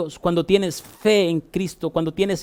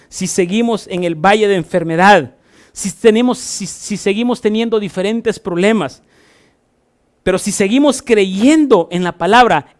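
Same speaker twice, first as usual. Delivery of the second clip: loud, higher, fervent preaching; 5 semitones higher, 6 dB louder than usual. Acted. Pastor encouraging the sick to keep believing, fervent preaching. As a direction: drive the promise home to the congregation.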